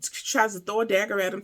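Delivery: ordinary talk, nothing marked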